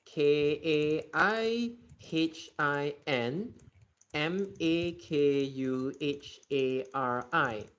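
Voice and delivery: slow letter by letter spelling. Shown as a demonstration. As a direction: spell each letter slowly and clearly. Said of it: "spelling out station name".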